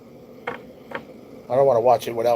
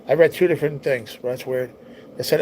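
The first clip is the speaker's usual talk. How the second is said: normal talking voice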